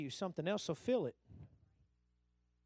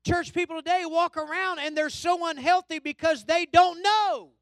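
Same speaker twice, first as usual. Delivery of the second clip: high, forceful pulpit admonishing; impassioned preaching; 10 semitones higher, 11 dB louder than usual. Real, not acted. Preacher admonishing church people who don't know, impassioned and forceful. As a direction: press the point home to the whole church.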